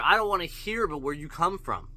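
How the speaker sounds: ordinary talk, nothing marked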